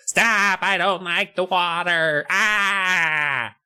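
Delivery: high, drawn-out nasal whine; high nasally Lauren. Retold or imitated. Lauren getting sprayed with water guns, high nasally whine. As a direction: squeal it through the nose, hammed up. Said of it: "she has a very high nasally voice".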